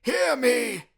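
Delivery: high, very loud commanding cry; booming spectral call; ghost crying out. Acted. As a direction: demand to be heard. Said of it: "cried the ghost".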